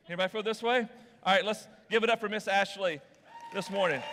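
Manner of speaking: ordinary talk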